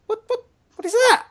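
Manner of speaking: sudden high startled question. Real, not acted. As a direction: react to a weird noise. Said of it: "I don't know what that is".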